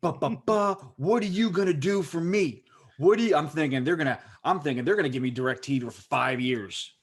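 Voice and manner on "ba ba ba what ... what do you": high, worked-up mock rant; retold yelling. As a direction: act out yelling at the phone agent, pitched up high. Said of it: "I'm yelling and screaming".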